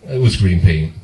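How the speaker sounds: usual speaking voice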